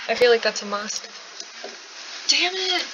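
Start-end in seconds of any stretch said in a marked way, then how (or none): 2.3-2.8 frustrated outburst. Real, not acted. Sweater just ruined by blood.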